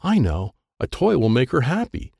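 ordinary delivery, nothing marked

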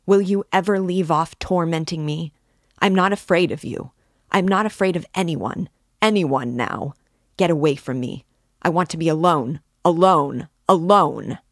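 loud, agitated outburst; frenzied cry. A narrator's reading. Raskolnikov wanting to be alone, crying in a frenzy. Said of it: "Raskolnikov cried in a frenzy".

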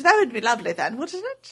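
light mock British accent; breezy British accent, rising tag question. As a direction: toss it off in a playful British accent. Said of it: "I got bust out an accent".

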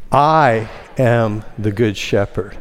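slow phrase, leaning on "I"; stress on I. Shown as a demonstration. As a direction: draw out "I" as the emphasized first word. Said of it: "emphasize the first word".

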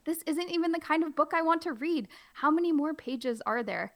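high, baffled complaining; hammed-up confusion. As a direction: play the startled reader. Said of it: "play it up and be funny".

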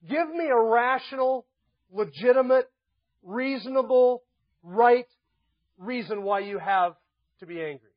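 slow, high and loud, word by word, dropping at the end; emphatic challenge. Acted. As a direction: hammer "rational", "legitimate", "reasonable" and "right" separately. Preacher voices God challenging Jonah.

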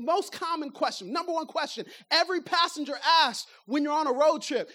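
ordinary talk, nothing marked

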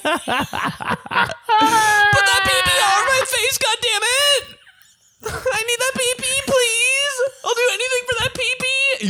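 high wailing desperate plea; screaming falsetto beg. Acted. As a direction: shriek it like begging. Acted desperate begging.

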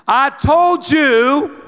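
slow, loud, drawn-out scolding; loud theatrical yelling. Retold or imitated. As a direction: bellow it at the kid upstairs, stretching every word. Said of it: "And I get louder and louder in theatrics".

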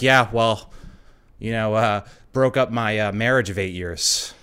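a hint of the driver confiding casually; mild imitation of an oversharing driver. Voicing the driver's reveal.

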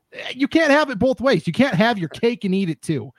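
exasperated, starting high-pitched; fired up. Real, not acted. Host scolding the governing body for wanting it both ways, fired up. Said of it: "don't get me fired up".

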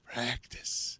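hushed, whispered echo. Acted. Host echoing the practice rant, whispered. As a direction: whisper it as a running gag.

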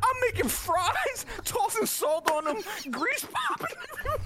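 very high, squeezed through laughter; helpless laughing. Real, not acted. Storyteller cracks up describing making fries exposed, helpless laughing. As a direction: barely get the words out for laughing.

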